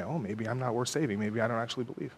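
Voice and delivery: soft, low self-doubt; doubting believer. Acted. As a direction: murmur it to yourself, giving in. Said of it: "And you say".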